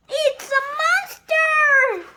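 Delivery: high loud panicked yell; scared scream. Acted. Dragon screaming about a monster, high loud panic. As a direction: shriek it in terror. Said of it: "he screamed".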